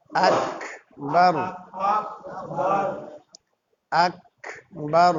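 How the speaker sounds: আকবার slow, with a small echoing bounce on ক and a rising end; qalqalah on ক. Shown as a demonstration. Teacher shows students the ক sound, slow and rising. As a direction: stretch আকবার, let ক make a tiny sound. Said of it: "কাফের উচ্চারণের ভিতরে একটা ছোট্ট একটা আওয়াজ হবে".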